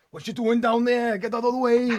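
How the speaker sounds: loud, high valleys Welsh shout; strong Welsh accent. Retold or imitated. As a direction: bellow it like a Welsh miner. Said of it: "we tend to do the Welsh accent".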